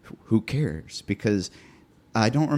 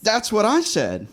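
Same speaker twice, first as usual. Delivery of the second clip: high-pitched, insistent exclamation; emphatic agreement; 11 semitones higher than usual. Real, not acted. Podcaster repeats agreement with co-host, high and emphatic. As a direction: insist on it, vindicated.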